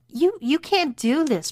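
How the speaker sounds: high-pitched, stammered protest. Acted. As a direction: object in alarm, pitch jumping up. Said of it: "protested Brittany".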